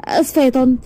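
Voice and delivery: mildly upset apology. A narrator's reading. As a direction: apologize with a touch of hurt. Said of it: "بصت لها وقالت بزعل". (اعتذار فيه شوية زعل)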